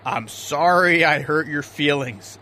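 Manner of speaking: slow, low soothing sorry; gentle apology. Acted. Skipper apologizes to sensitive deckhand.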